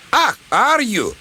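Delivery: high-pitched indignant challenge; angry outburst. A narrator's reading. Donald bursting out at the brake van, angry. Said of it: "Donald burst out".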